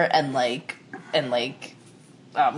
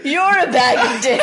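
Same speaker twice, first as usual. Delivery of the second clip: laughing quote; 7 semitones higher, 4 dB louder than usual. Retold or imitated. Friend's reply read out laughing.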